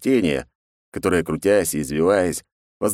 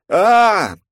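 loud high yell; 8 dB louder than usual. A narrator's reading. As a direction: scream as you're yanked away. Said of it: "это я воплю".

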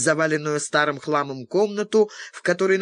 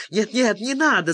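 higher, panicky pleading cry; frightened squeal; 6 semitones higher than usual. A narrator's reading. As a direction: squeal in terror to stop the shot. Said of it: "заверещал Карпентер". (испуганный визг)

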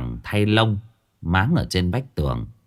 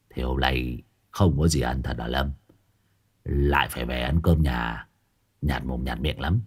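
low, soft, feeble mumbling; feeble rasp; 9 semitones lower, 5 dB quieter than usual. A narrator's reading. Ông thầy đói bụng thều thào với Lâm, yếu ớt. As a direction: sigh it out weakly, hungry. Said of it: "Thầy Phọt thều thào".

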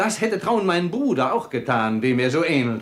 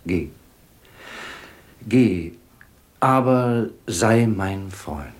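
moved, tender; 6 semitones lower than usual. Acted. Sultan humbly asks Nathan to be his friend, moved. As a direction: offer friendship gently, with long pauses.

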